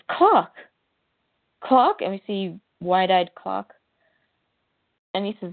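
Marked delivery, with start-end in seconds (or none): 0.1-2.0 high, loud mock scream of the name; retold scream. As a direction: call out the name in alarm. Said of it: "and somebody screams".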